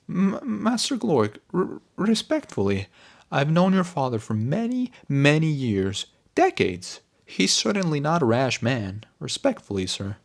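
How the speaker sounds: high, stammering, timid voice; nervous servant. Acted. Servant nervously defending the master's father, stammering. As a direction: stutter out a respectful protest.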